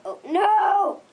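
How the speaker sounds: high, loud cry of alarm; shouting in dismay. Real, not acted. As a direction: yell it in panic. Sudden mishap.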